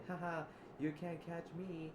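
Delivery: high-pitched mocking singsong; playful taunt. Zip taunting Edward during the chase, high mocking tease. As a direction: tease a pursuer from ahead.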